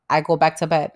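normal talking voice

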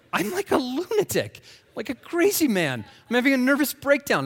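high, loud self-alarmed outburst; acted panic. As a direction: exclaim it like you're cracking up. Acting out his own panicked self-talk.

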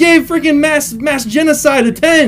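loud, high, incredulous yelling; comic disbelief shouted at the guest; outraged shout. Acted. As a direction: yell at him in mock outrage. Host outraged at guest's rating.

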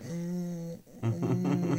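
high, soft fussy whimper; imitated unhappy baby. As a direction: whimper softly through closed lips. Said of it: "he's just not happy".

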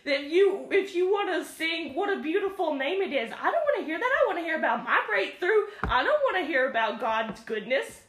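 high-pitched imitation of a griping churchgoer, half laughing; hammed-up protest with laughter in the voice; mock indignant whining. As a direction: complain in exaggerated disbelief. Mimicking churchgoers griping at slow song.

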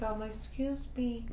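soft, slow, timid excuse me; timid polite. Retold or imitated. To busy post office clerks, soft and timid. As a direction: ease in meekly, barely daring to interrupt. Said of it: "I was like".

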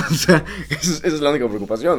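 laughing through the words, high and loud; entre risas. Real, not acted. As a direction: let laughter carry the voice up. Se ríe de la anécdota. (riéndose al hablar, agudo y fuerte)

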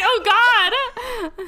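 high, loud mock dread; laughing fake alarm; mock panic. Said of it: "I was like".

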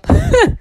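short high laugh; high burst of laughter. Real, not acted. After joke.

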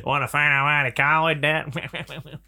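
slow leering flirt; sleazy pilot. Pretend. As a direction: drag out the innuendo.

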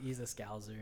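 normal talking voice

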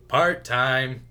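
loud, drawn-out mock hero line; hammed-up action hero quip; bad film take. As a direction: overplay it loudly, stretching the words. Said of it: "it was a way better take".

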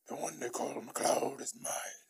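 slow, rasping, whispery villain. Acted. The Kurgan claiming MacLeod as his prey, slow raspy villain voice. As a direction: growl it out slowly as an evil warrior. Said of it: "And he's just like".

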